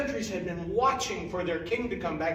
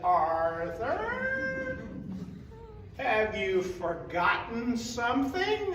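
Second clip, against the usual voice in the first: high, drawn-out call of his name, then slow; calling woman's voice; 6 semitones higher, much slower than usual. Acted. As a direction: call to him from the cabin, sweetly. Woman's voice calls from cabin.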